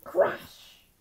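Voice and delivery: crashing noise, voiced loudly; loud crash sound. A narrator's reading. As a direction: whoosh out a breathy crash.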